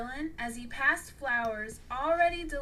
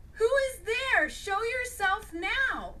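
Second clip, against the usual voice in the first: loud, high challenge into the dark; calling out; 10 semitones higher, 6 dB louder than usual. Acted. Mall guard shouts at hidden intruder, loud challenge. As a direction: call out sharply to an intruder.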